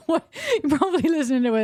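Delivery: amused, laugh breaking into speech. Real, not acted. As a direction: talk through giggles at your own joke. Laughs at own joke while talking.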